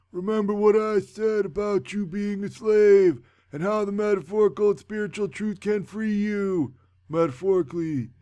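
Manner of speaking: hammed-up wizard sermon; mock wise mentor. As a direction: preach it like a wise old wizard. Dumbledore quoted lecturing.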